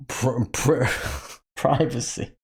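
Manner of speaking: stumbling into laughter on the word; real laugh breaking up the reading, slowing down. Real, not acted. Commentator cracking up reading the privacy claim, laughing. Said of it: "I don't mean to laugh".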